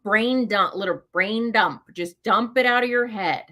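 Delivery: usual speaking voice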